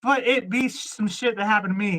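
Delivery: ordinary talk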